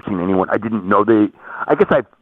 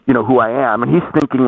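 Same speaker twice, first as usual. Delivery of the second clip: usual speaking voice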